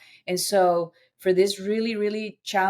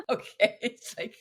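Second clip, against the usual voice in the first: laughing; 6 semitones higher, 5 dB quieter than usual. Real, not acted. Laughing mid-story.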